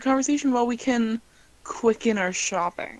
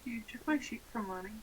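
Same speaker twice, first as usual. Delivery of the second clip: whispering; 15 dB quieter, much faster than usual. Real, not acted. Quiet aside during table talk.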